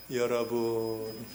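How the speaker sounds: slow, drawn-out address to the faithful; mock-grave pulpit greeting; solemn pope. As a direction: imitate a dignified cleric, low and stretched. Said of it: "아주 근엄하고".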